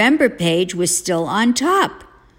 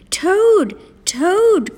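very high, loud call; high-pitched holler, falling at the end; crying out; 12 semitones higher, 5 dB louder than usual. A narrator's reading. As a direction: cry the name out to rouse someone. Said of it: "cried Frog".

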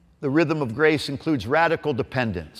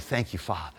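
soft prayer; 7 semitones lower, 13 dB quieter than usual. Shown as a demonstration. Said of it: "Interrupt it with".

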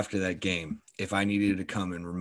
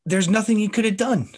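much higher, louder protest; emphatic exasperation; 12 semitones higher, 5 dB louder than usual. Real, not acted. Fan defending the beaten defender, high exasperated insistence. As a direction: insist on it, pitch jumping right up.

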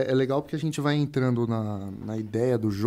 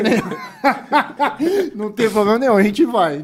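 higher and louder, laughing; 8 semitones higher, 5 dB louder than usual. Real, not acted. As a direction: laugh while insisting. Joking offer to voice shooting.

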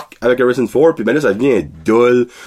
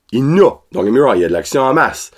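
normal talking voice

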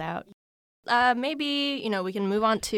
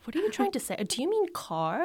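high, incredulous mimicry of classmates; mocking classmates; 9 semitones higher than usual. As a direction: sneer it like a puzzled American kid. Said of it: "and they were like".

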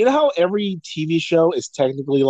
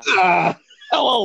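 loud hello; 6 semitones higher, slower than usual. Retold or imitated.

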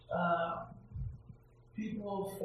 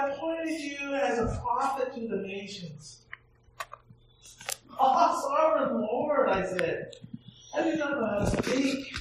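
louder, lifted proclamation of the verses; scripture reading; 4 semitones higher, 4 dB louder than usual. Acted. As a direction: read the prophet's call like a sermon. Reads scripture aloud.

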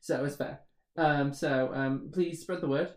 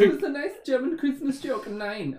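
higher mock-German delivery; really bad German accent; 9 semitones higher than usual. Echoing the co-host's German joke, really bad German accent. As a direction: echo the joke in a badly faked German accent. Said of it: "that's a really bad German accent".

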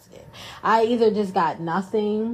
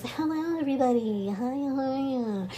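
lilting, higher, softer polite office greeting; code-switch voice; 4 semitones higher, 4 dB quieter than usual. Retold or imitated. Greeting coworkers when she first started, higher proper polite voice. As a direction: put on the careful, proper work voice. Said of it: "I was on that code switch".

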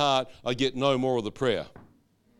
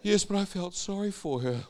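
high-pitched imitation of a tender-hearted mother; sympathetic mother; 8 semitones higher than usual. Mother defends her pity to her son, in a high imitated voice. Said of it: "Mother, it's a soap opera".

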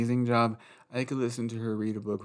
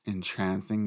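ordinary talk

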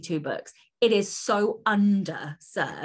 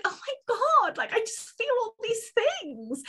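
much higher, swooning over a book; gushing excitement. Retold or imitated. Author recalling reading a love story, high gushing excitement. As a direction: gush like a smitten fan. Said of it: "I was like".

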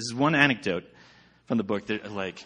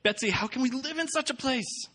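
distressed Corrie; 4 dB quieter than usual. A narrator's reading. Corrie's despair in excerpt.

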